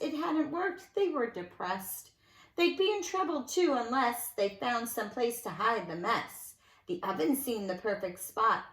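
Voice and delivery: lilting rhymed read-aloud; sing-song storybook telling; story narration. A narrator's reading. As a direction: read it to children with bounce. Reads a rhyming picture book.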